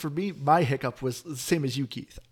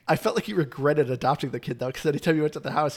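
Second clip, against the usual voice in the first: normal talking voice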